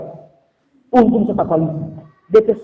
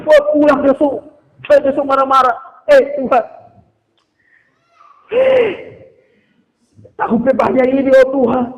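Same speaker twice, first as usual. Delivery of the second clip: raised, loud grumbling at God; hammed-up exasperated scolding; acted grumbling anger; 7 semitones higher, 4 dB louder than usual. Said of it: "so marah sama Tuhan". (omelan kesal yang dibuat-buat)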